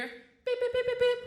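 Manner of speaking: high beeping imitation; metal detector beeps. Imitating the guard's detector going off. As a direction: beep like a hand scanner.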